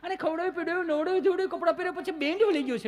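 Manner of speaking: high, fast panicked plea; frantic high-pitched parent voice; panicked mother. Retold or imitated. Worried mother explains child's state.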